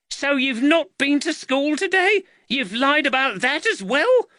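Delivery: high, indignant scolding; outraged mum catching out a lie; angry mother. A narrator's reading. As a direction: challenge the boy in a high, cross voice. Angry mother scolds her son.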